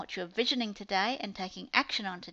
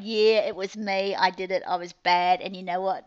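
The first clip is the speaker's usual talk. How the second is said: loud, lightly acted child's confession; imitating owning up; 7 dB louder than usual. Aunt imitating great niece owning the biscuit raid, mock confession. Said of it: "I just love how she's just gone".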